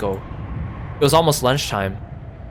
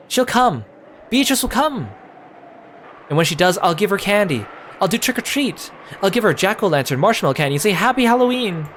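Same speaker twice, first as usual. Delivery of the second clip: put-on higher, eager little girl voice; bubbling over that the witch is coming; a small girl insisting the witch will come; excited child; 6 semitones higher than usual. Maria stubborn and eager.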